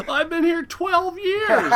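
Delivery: high, loud drawn-out wail; hammed-up shouted complaint, slow and high; indignant protest. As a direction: bellow it like the aggrieved new guy. Deckhand protests, acted loudly.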